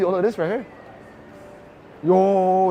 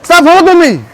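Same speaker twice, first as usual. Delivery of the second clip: loud high yell; shouting; 8 semitones higher, 10 dB louder than usual. Real, not acted. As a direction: yell while struggling free. Being held back, yelling.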